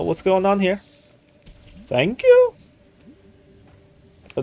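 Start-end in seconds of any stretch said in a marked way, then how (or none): 1.9-2.5 squeaky surprise. Real, not acted. Puzzled at game event.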